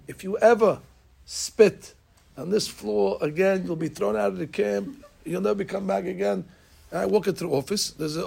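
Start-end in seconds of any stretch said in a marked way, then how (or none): 0.1-6.4 raised, higher mock yell at a boy; half-hearted angry camp owner yelling; retold scream.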